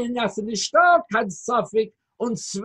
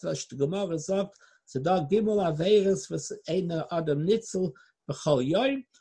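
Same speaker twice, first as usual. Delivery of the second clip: ordinary delivery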